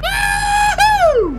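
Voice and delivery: high, loud "woo-hoo" whoop; gleeful shouted whoop; whooping cheer. Real, not acted. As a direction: let out a big high-pitched whoop. Cheering while jumping into game.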